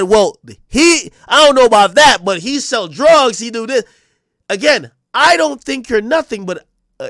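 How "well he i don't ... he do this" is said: loud, high mocking quote; mock him loudly. Mocking Thug's words to police.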